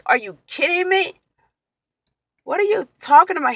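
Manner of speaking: high-pitched, exasperated questioning; indignant disbelief. Retold or imitated. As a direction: re-enact an outraged comeback. Acts out angry retort to husband.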